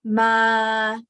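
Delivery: "ma" held level at mid range; steady middle-pitched "ma". Shown as a demonstration. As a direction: sustain "ma" on a middle note. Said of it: "mid pitch".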